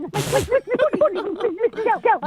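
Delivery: high, quavering mock English accent; flustered, panicky parody of an English lady; Missus Doubtfire English accent. Co-host on Christmas pudding, panicky mock English accent. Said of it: "you just sound like you're in a".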